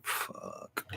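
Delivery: soft, breathy-whispered expletive; dismayed whispered curse. Real, not acted. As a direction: let it out under your breath. Realizes he is twenty minutes late.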